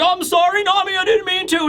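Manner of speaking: high, pleading sorry; squeaky apologetic giant; whiny apology. Retold or imitated. Road apologizing to Nami, high whiny. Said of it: "Road is like".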